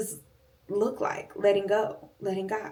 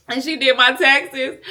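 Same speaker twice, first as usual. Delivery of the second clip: laughing in disbelief; 6 semitones higher, 8 dB louder than usual. Real, not acted. Complaining about mom doing taxes.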